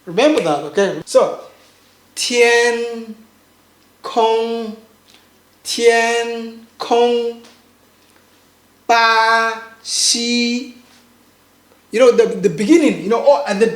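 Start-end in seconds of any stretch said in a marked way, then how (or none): 2.1-7.4 TIAN KONG, slow flat syllables; first tone. Shown as a demonstration. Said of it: "a bit long". 8.9-10.7 BA XI, both syllables long and level; first tone. Shown as a demonstration. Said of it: "a bit long".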